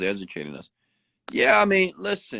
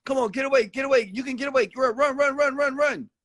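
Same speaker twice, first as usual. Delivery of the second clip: much higher cheering of a runaway; mock crowd cheering. Bystanders urging the car thief on, imitated high. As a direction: egg the thief on to escape.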